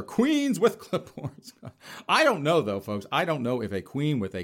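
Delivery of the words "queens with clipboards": high, slow, drawn-out voice; grand announcement. Acted. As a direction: announce it like a regal reveal. Introducing the respectful alternative.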